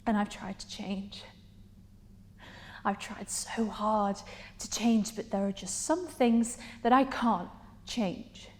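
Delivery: soft, halting admission with pauses; subdued pleading. Acted. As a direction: plead quietly, leaning on the effort. Monologue, pleading softly.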